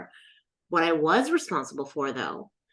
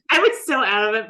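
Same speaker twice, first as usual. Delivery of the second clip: ordinary talk, nothing marked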